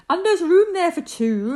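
delighted little bird; cheerful bird. Acted. Zinazoo bird celebrating room.

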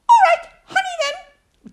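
small, high, squeaky little piglet voice. Acted. Narrator voicing Piglet fretting about the trap, high and squeaky. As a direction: squeak it out high like a tiny worried animal.

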